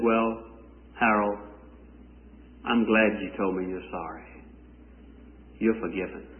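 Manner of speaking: mother forgiving her son at bedtime, soft and tender. Retold or imitated. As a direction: hush it like a mother at the bedside.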